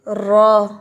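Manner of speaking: letter pronunciation; letter name. Shown as a demonstration. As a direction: pronounce the letter रॉ fully. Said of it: "वो क्या है".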